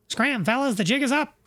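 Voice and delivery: squeaky little bug telling the gang to scram; high gangster bug voice. Acted. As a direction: pitch up into a tiny wiseguy bug. Bugs talking, voiced.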